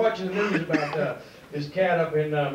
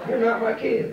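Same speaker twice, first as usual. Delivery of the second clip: ordinary delivery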